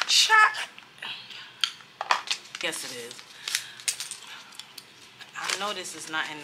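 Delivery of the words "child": high exasperated exclamation. Real, not acted. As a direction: throw up your hands.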